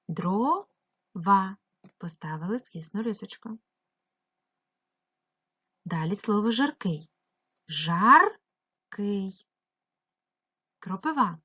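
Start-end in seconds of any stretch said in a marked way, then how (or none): 0.1-1.6 "Дрова" in two drawn-out syllables with a gap; split into syllables. Shown as a demonstration. Teacher dividing a word into syllables, syllable by syllable. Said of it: "ділимо слово дрова на склади". 7.7-9.5 "Жаркий" as two separate syllables, the first louder and rising; split into syllables. Shown as a demonstration. Teacher showing pupils how a word divides, syllable by syllable. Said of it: "поділити ці слова на склади".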